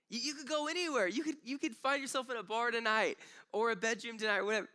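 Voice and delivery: high, pleading, loving voice of God; voice of God the Father. Pretend. Father telling us we can't sin enough, high and tender. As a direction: let the Father's love spill out, high and earnest.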